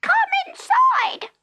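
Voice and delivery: very high, slow, tiny fairy voice; fairy man. Acted. Fairy man inviting Jenny inside, very high and slow. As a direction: beckon her in like a little sprite.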